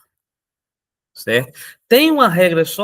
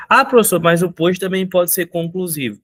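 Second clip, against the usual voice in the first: objeção de aluno, mais aguda e forte; aluno contestando; 4 semitones higher, 4 dB louder than usual. Acted. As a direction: interrompa como um aluno questionando o professor. Voz de aluno que contesta. (aluno questionando, em tom agudo)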